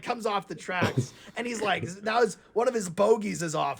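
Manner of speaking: ordinary delivery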